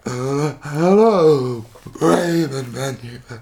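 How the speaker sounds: slow, strained words on the in-breath; speaking while inhaling. Shown as a demonstration. Testing an odd new character voice, speaking while inhaling. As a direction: suck the air in as you talk. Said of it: "speak while inhaling".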